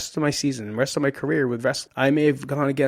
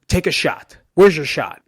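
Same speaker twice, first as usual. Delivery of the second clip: raised, loud retold demand; coach goading; 3 semitones higher, 8 dB louder than usual. Coach demanding an attack from his wrestler, loud and goading. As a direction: call it out like an impatient coach.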